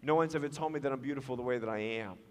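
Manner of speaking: soft, low, hurried murmur; imitated whispering. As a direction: confide it in someone's ear, deeply moved. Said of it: "she whispered in my ear".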